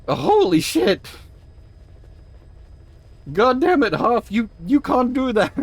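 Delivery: real high shocked outburst. Real, not acted. Streamer reacting to the mission twist, high and shocked.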